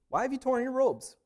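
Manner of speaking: high, loud, pressing question; urgent challenge. Acted. Prophet's message quoted in sermon.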